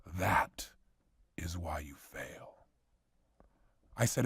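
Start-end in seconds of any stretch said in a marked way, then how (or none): 0.0-2.7 put-on hushed, much lower wise old Jedi whisper; slow, breathy Yoda rasp, much lower. A speaker imitating Yoda for the audience, in a slow, much lower whisper. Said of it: "And Yoda says".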